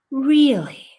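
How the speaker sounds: high, loud incredulous "Really?"; sharp rising disbelief at the news; shocked disbelief. Retold or imitated. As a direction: let the shock jump out of you. Retold shocked reply to gossip.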